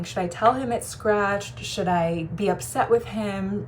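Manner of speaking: normal talking voice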